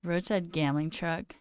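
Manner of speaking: normal talking voice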